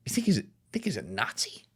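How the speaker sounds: hushed, stunned whisper; incredulous hushed question; disbelieving whisper. Retold or imitated. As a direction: whisper it like you can't believe it. Incredulous friend repeating the claim.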